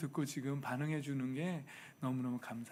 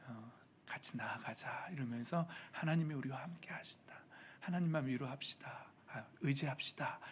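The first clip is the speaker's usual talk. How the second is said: hushed, whispered encouragement; 2 semitones lower, 8 dB quieter than usual. Retold or imitated. Wife quietly encouraging her husband in a whisper. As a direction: breathe the comforting words softly.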